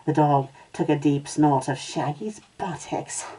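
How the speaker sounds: plain reading voice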